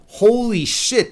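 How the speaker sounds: high, drawn-out holy shit; slow stunned exclamation; shocked outburst. Real, not acted. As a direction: blurt it out in disbelief. Outraged reaction to the story.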